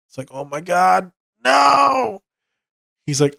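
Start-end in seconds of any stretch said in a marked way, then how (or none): 0.3-2.2 high-pitched exaggerated shout; imitated over-the-top cry of disbelief; mock outcry. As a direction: jump way up into a hammed-up "no". Acts out character's exclamation.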